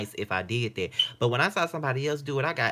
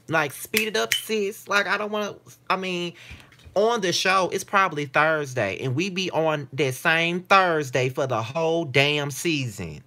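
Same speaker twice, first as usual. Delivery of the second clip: higher, louder rant; exasperated rant; 7 semitones higher, 5 dB louder than usual. Real, not acted. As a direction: vent at the writers. Rants at Tyler Perry.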